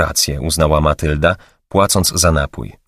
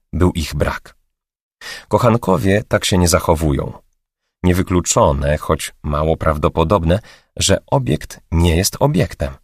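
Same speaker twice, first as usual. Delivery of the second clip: ordinary delivery, nothing marked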